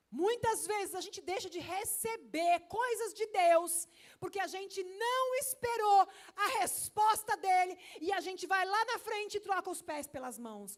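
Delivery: loud, high, slow exhortation; slow, loud pulpit cry; full-force preaching. Acted. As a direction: hammer the warning home from the pulpit.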